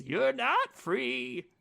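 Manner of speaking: slow, menacing villain line, lightly voiced; a hint of the creepy villain; Creon's voice. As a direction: drag out the threat slowly. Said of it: "in Creon's voice".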